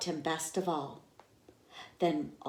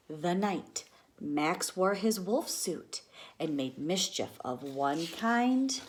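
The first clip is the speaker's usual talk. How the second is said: usual speaking voice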